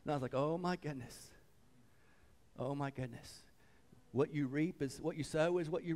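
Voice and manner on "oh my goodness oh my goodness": retold quiet reaction, dropping away; hushed dismay. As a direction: say it softly to yourself.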